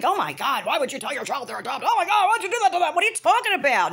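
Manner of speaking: high, fast, scandalised gasp; mock outrage. Mocking people shocked a child was told about adoption, high. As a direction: shriek it like a shocked busybody. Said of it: "like, people think".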